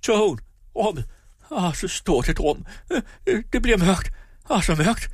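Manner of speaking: very high, strained wail without full volume; terrified crying out. A narrator's reading. Husband calling Lina as darkness closes in, terrified. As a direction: cry for help as terror takes over. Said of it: "gav sig til at skrige".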